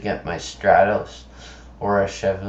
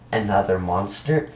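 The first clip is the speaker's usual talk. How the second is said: ordinary talk, nothing marked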